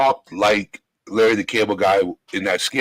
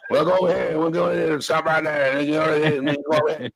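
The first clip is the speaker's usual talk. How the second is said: imitating a fast, hard-to-follow Southern accent, a bit higher; thick Southern accent; 4 semitones higher than usual. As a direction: rattle it off like the fast-talking Southern stranger. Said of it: "That's how he sounded".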